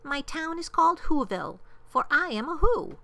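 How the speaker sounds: high, proud little mayor; piping, thankful small-town official; tiny mayor calling. A narrator's reading. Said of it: "called the voice".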